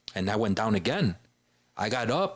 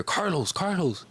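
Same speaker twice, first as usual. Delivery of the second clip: raised, urgent call pitched high; calling out a name; 8 semitones higher, slower than usual. Retold or imitated. Cousin calling out to find wounded Carlos, urgent raised call. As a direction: call his name across the street to find him.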